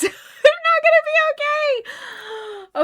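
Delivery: high, slow laughing sob; half laughing, half crying it out. Real, not acted. Vlogger overwhelmed by the episode, laughing through tears.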